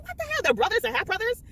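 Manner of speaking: squeaky high shocked "What the hell?"; reacting to a twist, voice jumps high; stunned disbelief. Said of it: "I'm like".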